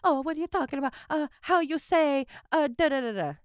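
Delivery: mock Italian accent. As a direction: ham up a movie Italian accent. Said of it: "There's even a point in Gucci".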